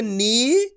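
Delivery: नी with the ee held long; drawn-out long ee. Shown as a demonstration. Teacher shows how to read the syllable, vowel drawn out. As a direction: stretch the vowel of नी. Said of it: "तो ना को कैसे पढ़ेंगे".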